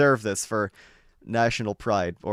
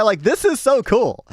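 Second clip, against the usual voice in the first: excited; 11 semitones higher, 9 dB louder than usual. Real, not acted. Thrilled by glass floor idea.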